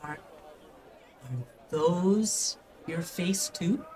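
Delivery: put-on slow, whispery child voice; curious child. Child asking about flapping ears, hushed wonder. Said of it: "the child goes like".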